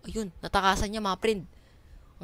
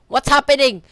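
high, loud shouting, climbing higher; panicked confusion; 7 semitones higher, 11 dB louder than usual. Real, not acted. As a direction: yell in mounting alarm. Sudden confusing event in game.

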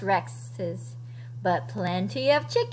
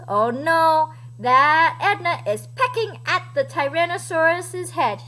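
plain reading voice

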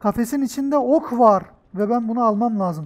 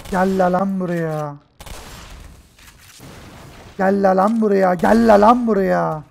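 angry taunting shouts; 6 dB louder than usual. Real, not acted. Taunting monsters in a fight.

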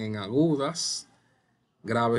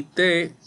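"te" punched higher and louder; strong syllable; 6 semitones higher, 6 dB louder than usual. Shown as a demonstration. Teacher showing the class biblioteca's strong syllable, stressed "te". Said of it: "que es la sílaba fuerte".

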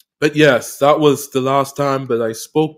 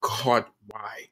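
soft, hushed "God, why?" asked inwardly; inner question; 6 semitones lower, 11 dB quieter than usual. Retold or imitated. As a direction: murmur "God, why?" under the breath as a private thought. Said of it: "in my head".

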